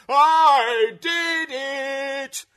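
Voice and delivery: huge, slow, high-pitched bellow. A narrator's reading. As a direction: bellow it, stretching every word. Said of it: "then she bellowed it".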